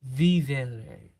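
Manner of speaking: Vivere with vi-VE-re stress, falling; correct Vivere. Shown as a demonstration. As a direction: Vivere diucapkan benar, nada turun. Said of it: "you got it right".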